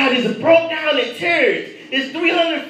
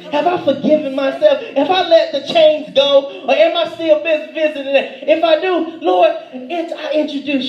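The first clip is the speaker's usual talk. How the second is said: louder, rising preacher's plea; fervent preaching; 3 semitones higher, 5 dB louder than usual. Acted. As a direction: lift the congregation, pleading loudly. Preaching at full force.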